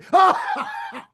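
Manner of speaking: very high-pitched burst of laughter; long, squealing laugh. Real, not acted. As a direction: break into a high cackle. Laughs at own teasing.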